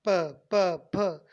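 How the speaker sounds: loud "प, प, फ" burst out with air pressure; forceful puff of air. Shown as a demonstration. Teacher shows air coming out with pressure, loud plosive "प, प, फ". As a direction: pop "प, प, फ" hard, releasing breath on each. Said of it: "pressure के साथ बाहर आ रही है".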